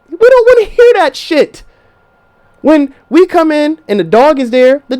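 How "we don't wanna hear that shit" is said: exasperated. Real, not acted. Exasperated outburst.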